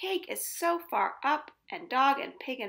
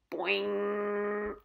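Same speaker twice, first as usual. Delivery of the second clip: plain reading voice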